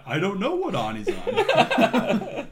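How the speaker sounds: primitive person, laughing. Retold or imitated. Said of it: "so it's like".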